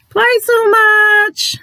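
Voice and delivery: very high, loud, drawn-out mock cry; exaggerated squeal. Vlogger teasing viewers who play too much, exaggerated high squeal. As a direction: stretch it out high and loud for comic effect. Playful repeat, exaggerated for emphasis.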